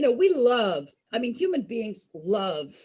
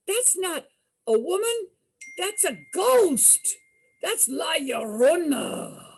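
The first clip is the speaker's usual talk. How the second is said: higher, slow, spooky announcement; spooky reveal; 7 semitones higher, slower than usual. Retold or imitated. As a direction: lean into the scary name. Cousin names the ghost.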